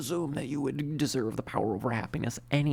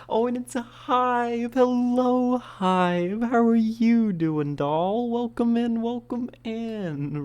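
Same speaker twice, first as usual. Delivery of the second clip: high, bright, loud welcome; excited greeting; 11 semitones higher, 5 dB louder, slower than usual. Real, not acted. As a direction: greet them with big excitement.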